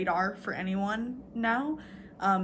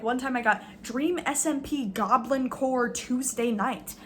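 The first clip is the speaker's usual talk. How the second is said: normal talking voice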